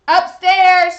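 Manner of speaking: loud shout upstairs; yelling across the room. Real, not acted. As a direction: call it up the stairs. Calling Zachary upstairs.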